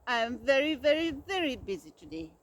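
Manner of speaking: loud, rushed angry brush-off; angry bluster. A narrator's reading. Bear dismissing Mouse, fast angry bluster. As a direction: hurry him away, fuming. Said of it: "Bear trembled with anger".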